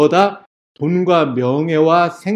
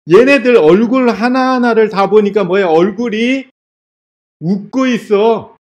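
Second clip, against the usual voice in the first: acting out a furious, baffled tyrant, higher and pushed; mock rage building to a loud repeated demand; imitated angry outburst; 5 semitones higher, 4 dB louder than usual. Nero raging to his aide about smiling martyrs, imitated angry exasperation. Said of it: "하고 막 화를 내요".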